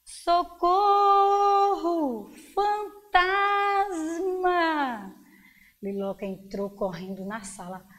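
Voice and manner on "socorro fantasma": high, drawn-out cry of terror; storyteller's stretched scream for help. A narrator's reading. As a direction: cry out long and high in fright. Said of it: "ouviram um grito".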